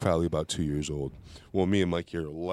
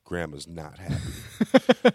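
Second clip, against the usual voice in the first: ordinary talk, nothing marked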